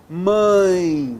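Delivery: Mãe said clearly and nasal, gliding to the i; Mãe as a nasal diphthong example; demo word. Shown as a demonstration. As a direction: model Mãe slowly for the class. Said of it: "vogal, semivogal".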